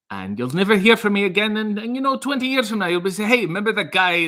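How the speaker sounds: old teacher's bad Scottish accent rising high and worked up. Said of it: "my bad attempt at a Scottish accent".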